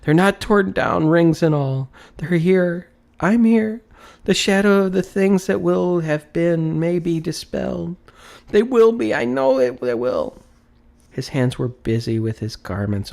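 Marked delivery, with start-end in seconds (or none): 0.0-10.4 high, loud, breathless joy; overjoyed. A narrator's reading. Scrooge rejoicing that the curtains are still there, excited. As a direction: bubble over with relief.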